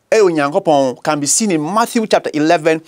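ordinary talk

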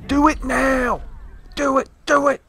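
high, pressing commands; mock boss urging; urgent urging. Real, not acted. Urging teammate in game.

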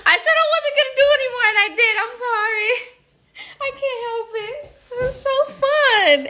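high giggly pop star apology. Retold or imitated. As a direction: giggle through it like a pop diva. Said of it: "to do impressions of [name]".

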